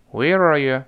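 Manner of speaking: "Where are you" run together, "you" weakened to "ya"; linked, weakened words. Shown as a demonstration. Teacher leads students in the first three words, linked and weakened. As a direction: glide through "Where are you" as one word, ending in "ya". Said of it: "体会一下这个口型和声音".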